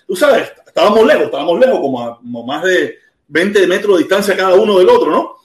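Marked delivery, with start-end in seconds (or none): none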